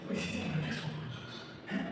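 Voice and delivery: urgent hushed whisper. Real, not acted. Spotting the CCTV camera.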